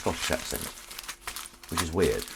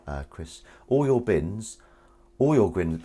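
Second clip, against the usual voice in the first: ordinary delivery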